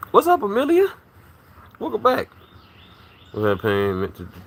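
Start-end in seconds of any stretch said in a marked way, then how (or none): 0.1-2.3 high, bright sing-song hello to a viewer; excited greeting. Real, not acted. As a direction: greet her like you're thrilled she came back.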